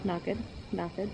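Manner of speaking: low murmured running commentary; quiet muttering. Real, not acted. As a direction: mutter it under your breath. Watching horse's gait, muttering.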